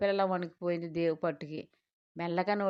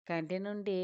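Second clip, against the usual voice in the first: usual speaking voice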